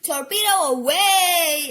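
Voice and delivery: loud, drawn-out launch call; hammed-up control-room call; intercom announcement. A narrator's reading. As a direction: announce it high and slow. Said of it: "announced torpedo control over the intercom".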